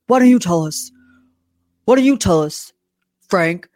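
imitating a puffed-up, pompous man; mock self-important demand, chest puffed up. Pompous man challenging Frank in front of everyone, imitated pompously. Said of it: "he's just so pompous".

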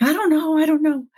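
high-pitched flustered protest; helpless, high replayed dither. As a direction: reenact being at a loss, pitched well up. Flustered reply to a friend's question.

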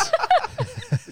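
squeaky burst of laughter; high giggle. Real, not acted. Cracking up at the bit.

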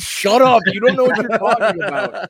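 high, loud retold "shut up" outburst; mock-exasperated shout breaking into laughter; exasperated yell.

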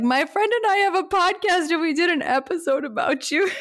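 high gushing rush of words; excited fan gush. Retold or imitated. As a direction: blurt it out like a starstruck fan. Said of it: "I was like".

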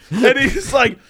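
ordinary talk

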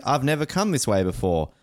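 normal talking voice

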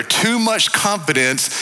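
ordinary talk

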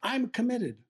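put-on high, sudden blurt of a young man; nervous kid blurting his answer. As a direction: let it burst out before you can think. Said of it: "I just blurted out of my mouth".

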